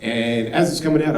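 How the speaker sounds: normal talking voice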